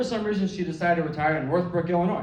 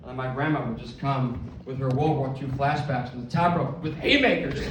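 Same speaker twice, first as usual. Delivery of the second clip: ordinary talk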